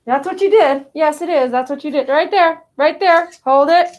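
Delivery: loud, bright praise for the pet; excited praise. Real, not acted. Owner cheering the pet on, loud and excited.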